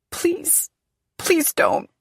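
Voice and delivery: high, frightened begging. Acted. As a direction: plead for mercy, voice climbing. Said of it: "I beg".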